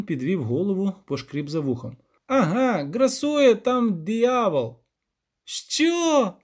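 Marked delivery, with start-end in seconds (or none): 2.3-4.8 drawn-out, higher villager's revelation; slow, pitched-up peasant announcement; rustic village elder. Acted. Elder revealing the trouble to guests, slow rustic voice. 5.4-6.3 very high-pitched incredulous yelp; snorting disbelief. A narrator's reading. Poet hearing of a devil, high incredulous snort. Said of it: "пирхнув Любисток".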